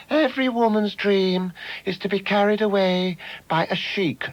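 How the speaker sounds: high, breathy-free swooning recital imitation. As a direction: recite it like a gushing romantic novelist. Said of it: "she recites stuff like".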